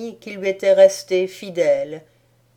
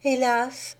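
high, plaintive doll voice; a proud little doll wailing at her fate; doll's lament; 8 semitones higher than usual. A narrator's reading. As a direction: princess doll crying out in despair. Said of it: "disait mademoiselle Rose".

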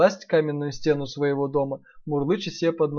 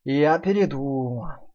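slow sly wolf; much slower than usual. Acted. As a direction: say it slyly, slowly. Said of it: "сказал он так громко".

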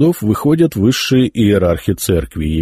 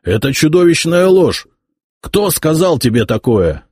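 raised, outraged protest; mild hammed-up indignation; indignant outburst; 9 semitones higher, 3 dB louder than usual. A narrator's reading. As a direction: reject the slander with outrage. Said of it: "возмутился Яромир".

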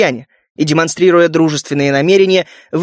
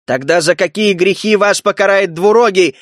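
usual speaking voice

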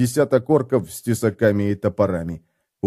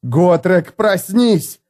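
shouting; 6 semitones higher, 3 dB louder, much slower than usual. Acted. Felix shakes sleeping Gotrek awake.

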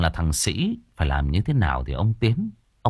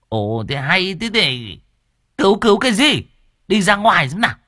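exasperated; 10 semitones higher, 5 dB louder than usual. A narrator's reading. Cô An shoos bà Nụ.

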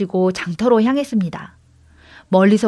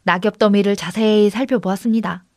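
usual speaking voice

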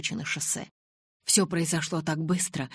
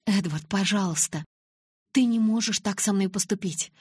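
higher, louder desperate plea; pleading; 5 semitones higher, 3 dB louder than usual. A narrator's reading. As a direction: beg him not to do this. Bella begs Edward. (отчаянная мольба)

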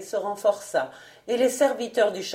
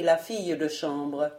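ordinary delivery, nothing marked

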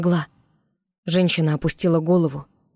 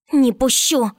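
growled; 8 semitones higher, 3 dB louder, slower than usual. Acted. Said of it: "прорычала она".

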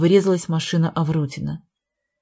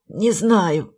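croaked hoarsely after the crash; hoarse rasp. Acted. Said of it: "прохрипел Гвидо".

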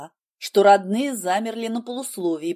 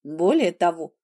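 ordinary delivery, nothing marked

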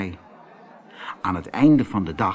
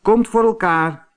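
cheerful reply; 9 semitones higher, 5 dB louder than usual. Acted. Antonio agrees cheerfully.

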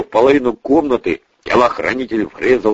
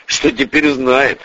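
ordinary delivery, nothing marked

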